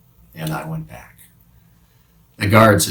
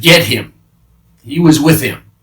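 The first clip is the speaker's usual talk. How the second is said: guard's shout; 6 semitones higher, 8 dB louder than usual. Acted. Guard calls out recognizing Peter.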